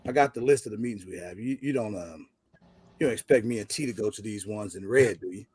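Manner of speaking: low, soft, hesitant polite request; nicest way. Retold or imitated. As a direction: imitate a friend asking ever so gently. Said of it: "says it to me in the nicest way".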